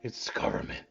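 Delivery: put-on conspiratorial drunk whisper. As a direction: whisper paranoid secrets. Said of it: "You gotta talk quietly".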